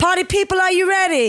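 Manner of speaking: rousing MC call to the players; hype-man call. Pretend. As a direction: rev up the party crowd. Hyping players for the quiz.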